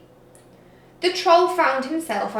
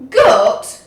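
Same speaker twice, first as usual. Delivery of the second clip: loud, falling disbelieving repeat; disbelief; 12 dB louder than usual. A narrator's reading. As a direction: repeat it flatly, appalled.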